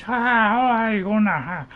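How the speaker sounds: put-on loud, high playful Irish accent greeting. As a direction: laugh it out in a cheery Irish brogue. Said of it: "do a touch of the Irish".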